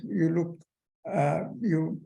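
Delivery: usual speaking voice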